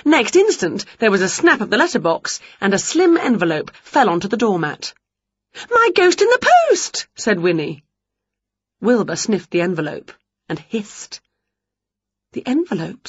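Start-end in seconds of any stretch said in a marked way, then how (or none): 5.8-6.9 much higher, loud delighted witch cry; overjoyed witch shouting; thrilled squeal. Acted. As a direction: squeal it with glee. Winnie thrilled.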